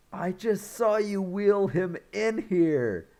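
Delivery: high, loud exasperated protest; indignant. Retold or imitated. He saw Bacon wheeled in, retold indignant outburst. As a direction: imitate someone calling out an obvious lie, loudly.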